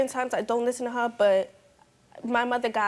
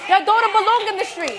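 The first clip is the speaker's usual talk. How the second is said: loud, high insult; angry yell; 8 semitones higher, 8 dB louder than usual. Real, not acted. Teen yells insult at heckling mother. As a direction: hurl it at her.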